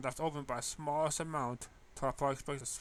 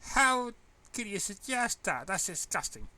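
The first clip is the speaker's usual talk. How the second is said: disgusted; 8 semitones higher, 6 dB louder than usual. Acted. Disgusted outburst continues.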